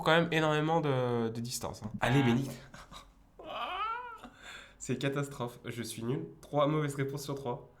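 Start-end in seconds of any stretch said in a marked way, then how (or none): 3.4-4.6 squeaky high-pitched laugh of despair; despairing giggle after a third wrong answer; high squealing laugh. Real, not acted. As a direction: laugh at your own failure, way up high.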